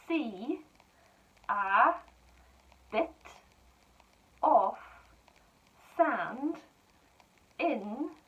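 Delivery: each word set apart and clear; slow dictation. Shown as a demonstration. Teacher dictating to pupils, slow and clear. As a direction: dictate slowly to young writers.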